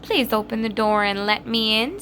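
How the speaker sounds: wolf faking a lamb's sweetness; wolf pretending to be a lamb, sweetly; sweet little voice. A narrator's reading. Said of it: "in a sweet little voice".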